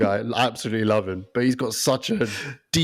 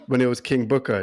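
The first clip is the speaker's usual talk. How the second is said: ordinary delivery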